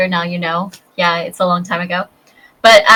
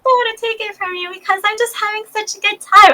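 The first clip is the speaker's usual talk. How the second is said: much higher, sweet put-on little girl; 13 semitones higher, faster than usual. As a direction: squeak it like a shy little girl.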